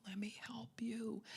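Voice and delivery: mock helpful whisper. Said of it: "It's like".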